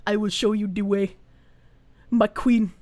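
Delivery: low, solemn meme voice offering guidance; Ugandan Knuckles. Retold or imitated. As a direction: intone it low like a strange little creature. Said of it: "that's Ugandan knuckles".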